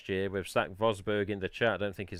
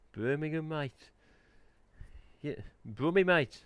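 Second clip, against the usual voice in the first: higher-pitched mock Brummie; Brummie accent; 5 semitones higher than usual. Streamer joking about Birmingham's tier three, mock Brummie accent. Mimicking Birmingham speech after naming it.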